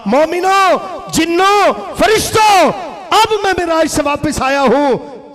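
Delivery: high, loud hail with each name stretched; calling out. Acted. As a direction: proclaim it to a vast gathering. Returning worshipper hails believers, jinn, angels. (नमाज़ी मोमिनों और फ़रिश्तों को ऊँची आवाज़ में पुकारते हुए)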